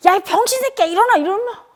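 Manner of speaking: high, loud mocking scream at a child; retold angry yelling. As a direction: yell it like a cruel mother waking her son. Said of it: "거기 소리".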